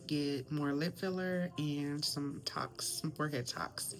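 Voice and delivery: whispering. Real, not acted. Hushed in the clinic.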